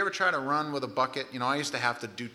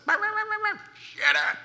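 a sharp, much higher, louder yell from the back porch; yelled shut up; 6 dB louder than usual. Retold or imitated. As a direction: yell it like scolding a dog from the porch. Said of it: "That's what he yells at the storm".

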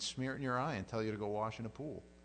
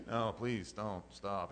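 half-hearted protest; 3 semitones lower, much slower than usual. Retold or imitated. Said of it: "he wants her to go".

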